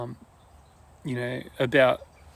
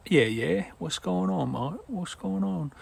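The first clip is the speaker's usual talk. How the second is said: higher, softer put-on British accent; mock British greeting, pitched up and lightly tossed off; joke UK accent; 5 semitones higher, 5 dB quieter, slower than usual. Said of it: "I was putting on a U K accent".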